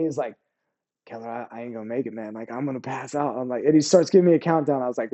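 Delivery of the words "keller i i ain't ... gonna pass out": low-volume, fading mock plea; imitated faint driver. Overheated driver telling his gunner he won't make it, imitated faintness. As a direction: imitate someone about to pass out, much softer.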